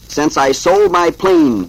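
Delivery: loud, slow, drawn-out cartoon rabbit; tough rabbit. A narrator's reading. Rabbit talks to himself beside his horse, lightly voiced tough. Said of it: "He's talking out loud to himself".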